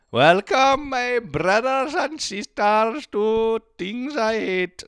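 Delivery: high, slow, grandiose preacher greeting; hammed-up show welcome. Host welcomes listeners to his show like a mock preacher. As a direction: greet the flock like a mock televangelist.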